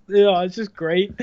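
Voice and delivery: ordinary delivery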